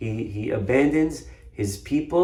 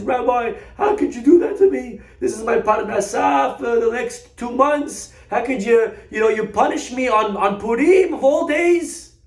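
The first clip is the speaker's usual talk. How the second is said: hoarse, tearful yelling; 12 semitones higher, 6 dB louder than usual. Acted. Freed shopkeeper in tears protests to rabbi, hoarse wailing. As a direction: wail it as if worn out from yelling. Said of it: "his voice is already hoarse from yelling all night long. He's in tears".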